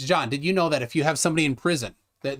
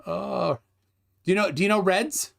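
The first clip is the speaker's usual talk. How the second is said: ordinary delivery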